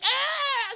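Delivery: high-pitched, loud startled scream; sudden high yelp; mock scream. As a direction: shriek as if shoved into traffic. Girl pushed into the road.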